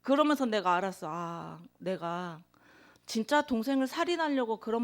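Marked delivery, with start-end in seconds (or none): none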